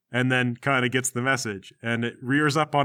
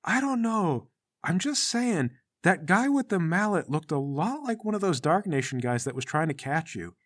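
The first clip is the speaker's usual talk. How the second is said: put-on high-pitched girl character; girl's voice; 4 semitones higher than usual. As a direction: lightly argue as a girl, pitched up. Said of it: "Sasha is saying".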